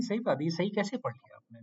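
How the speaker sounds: ordinary delivery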